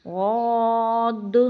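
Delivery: غاد drawn out thick, ending on a dal; heavy dhad. Shown as a demonstration. Teacher models a heavy غاد. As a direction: stretch غاد full-mouthed, close on dal. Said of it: "غاد کو موٹا کر کے تھوڑا پڑھیں گے۔".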